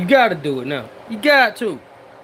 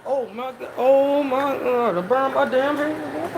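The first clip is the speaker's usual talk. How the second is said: slow, softer groaning through pain; 2 semitones higher, 4 dB quieter, slower than usual. Real, not acted. Scene: cook nurses his burned hand, pained. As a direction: wince through the words slowly.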